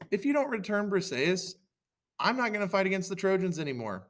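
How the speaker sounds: high-pitched, indignant threat; protesting ultimatum. Acted. Achilles threatens Agamemnon, high protesting voice. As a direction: make a high, acted protest.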